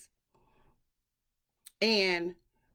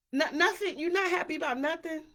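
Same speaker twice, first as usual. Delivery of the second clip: mock questioning; 8 semitones higher, faster than usual. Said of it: "It's just like".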